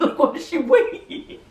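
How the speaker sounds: high-pitched, laughing disbelief; laughing exasperation. Real, not acted. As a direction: break into real laughter while complaining.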